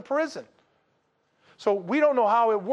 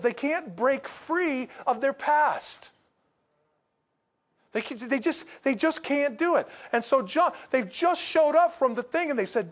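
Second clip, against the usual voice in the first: ordinary delivery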